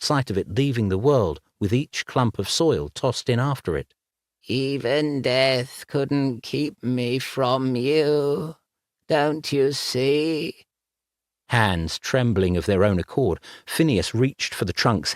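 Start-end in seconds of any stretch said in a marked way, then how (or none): 4.5-10.7 high, slow ghostly voice; eerie ghost voice. Acted. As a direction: coax him from beyond the grave. Dead lover speaking.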